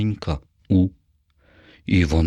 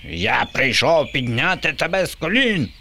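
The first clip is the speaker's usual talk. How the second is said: high, far zombie screech; shrieking zombie ruler; 7 semitones higher than usual. A narrator's reading. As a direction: screech it like a pompous monster. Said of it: "верещав Боба".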